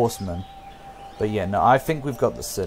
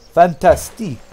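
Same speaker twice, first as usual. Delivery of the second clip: FANTASTIQUE louder and higher, Frenchified; mock French accent; 6 semitones higher, 7 dB louder than usual. Player relishing the idea, "Fantastique" in mock French. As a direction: say "Fantastique" with a playful French ending, fan-tas-TEEK.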